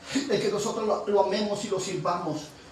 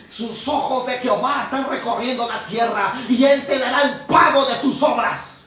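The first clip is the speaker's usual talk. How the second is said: full-force preaching; 7 dB louder than usual. Acted. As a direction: build the sermon to a loud climax. Preaching climax to congregation.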